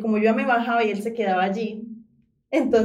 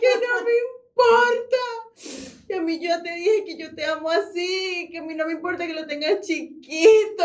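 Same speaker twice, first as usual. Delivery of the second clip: mock crying; 7 semitones higher than usual. Girl faking a dramatic breakup scene on the bus, mock sobbing. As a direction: fake tearful confession, dragged out. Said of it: "yo empiezo a llorar".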